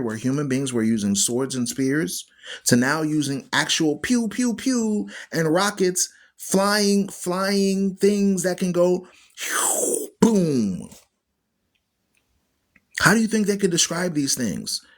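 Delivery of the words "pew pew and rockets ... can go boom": high playful "pew, pew" with a boom; mock weapon noises. Preacher miming rockets to listeners, playful. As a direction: imitate rockets like a kid.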